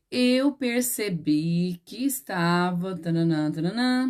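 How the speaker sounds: slow, drawn-out dictation with sing-song filler; slow model sentence trailing into placeholder syllables; dictating. Shown as a demonstration. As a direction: stretch each word out for pupils writing it down.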